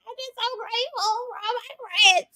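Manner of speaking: high, tearful imitated wail; mock crying. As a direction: whimper it through, half laughing at yourself. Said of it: "I started crying".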